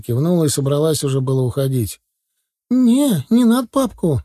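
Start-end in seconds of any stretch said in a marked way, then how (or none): 2.7-4.2 high, louder pleading yell; sudden boy's cry. Acted. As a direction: cry out in sudden alarm as a child. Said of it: "вдруг закричал мальчишка". (внезапный крик мальчишки)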